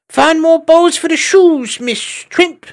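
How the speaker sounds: very high, loud piping order; a tiny cook ordering the maid about; bossy little squirrel. A narrator's reading. Chugger orders again.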